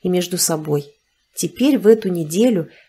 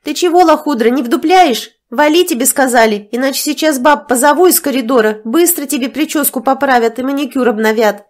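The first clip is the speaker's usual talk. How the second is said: higher, louder, rough threats; threatening; 6 semitones higher, 5 dB louder than usual. A narrator's reading. Nastya threatening the mistress with fists, angry. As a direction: menace her out of the room.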